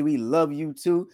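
usual speaking voice

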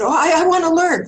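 mock eager student. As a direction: blurt it out, anxious to please. Eager reply to healer.

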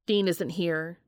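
usual speaking voice

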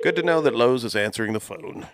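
sarcastic. Real, not acted. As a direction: say it with biting irony. No one answered the phone.

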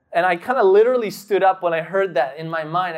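ordinary talk

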